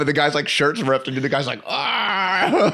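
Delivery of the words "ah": high-pitched drawn-out "aah"; high mock yell; imitated cry. Imitates beaten guy crying out.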